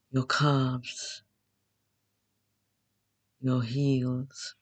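guided meditation. Acted. Host leading beginners through Yoga Nidra, calm guided meditation. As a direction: guide them unhurriedly into rest, phrase by phrase.

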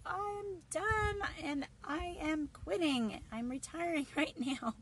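high, mock exasperated outburst; a hammed-up I quit; fed-up quitting. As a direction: throw up your hands, half laughing. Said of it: "you're just like".

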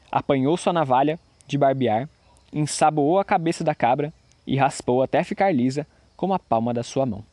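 usual speaking voice

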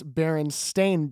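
"Berenstain" ending like the word "stain". Shown as a demonstration. Correcting the show's spelling for listeners, "Berenstain" ending in a clear -stain. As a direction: say "Berenstain" with a long a in the last syllable. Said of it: "It's S T A I N".

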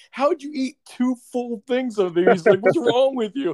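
high, disbelieving mock outburst, a bit slow at first; hammed-up amazed coworker voice; incredulous coworker. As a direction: imitate coworkers gawking in disbelief. Coworkers amazed at eating hot wings.

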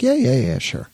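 quick high eager agreement; eager yes. Retold or imitated. Friends answering the guy, eager yes.

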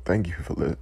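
quiet, choked-up words fading to breath; emotional. Real, not acted. Speaker overcome by grief for victims, quiet and choked. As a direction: hold back tears as the voice gives out. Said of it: "I gotta collect myself".

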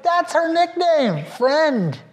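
high, slow awed exclamation; amazed realization. Retold or imitated. Pastor realizing the girl's nickname, high slow awe. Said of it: "I'm like".

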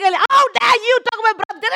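high, loud re-enacted fury; raging shout. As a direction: shout it like an outraged protective sister. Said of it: "full raging in the road, shouting".

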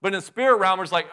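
normal talking voice

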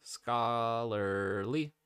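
"Scholarly" said very slowly, each syllable drawn out; "Scholarly" sounded out syllable by syllable; slow careful practice. Shown as a demonstration. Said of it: "I cannot say a word that ends with ly".

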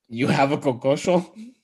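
a bad Nigerian accent on You have a concussion?, ending on a rise; deliberately bad Nigerian accent, played for laughs; Nigerian accent impression. Shown as a demonstration. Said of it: "don't actually try to do a good Nigerian accent".

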